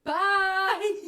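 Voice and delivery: high, loud cheerful bye; excited bye. Real, not acted. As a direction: call out bye brightly. Sign-off on camera.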